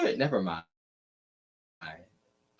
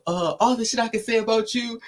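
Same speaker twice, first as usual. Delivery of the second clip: put-on New York accent; 10 semitones higher, 3 dB louder than usual. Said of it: "especially Ken's accent".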